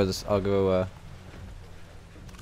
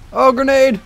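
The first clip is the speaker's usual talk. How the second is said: grenade warning yell; 14 semitones higher, 6 dB louder, slower than usual. Real, not acted. Calling out grenade in game.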